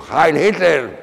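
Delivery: loud, high shouted salute; re-enacted salute at a shout. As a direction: call it out like a military salute. Acts out his saluting shout.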